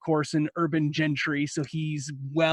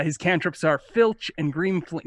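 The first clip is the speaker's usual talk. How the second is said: normal talking voice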